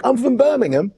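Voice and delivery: put-on high-pitched Birmingham English accent. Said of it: "start off with an English accent".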